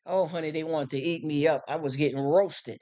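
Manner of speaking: ordinary delivery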